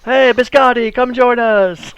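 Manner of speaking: shouted high greeting across the room; rowdy fisherman hollering a welcome; loud hail. Acted. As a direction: call them over loudly. Fisherman hailing party across tavern.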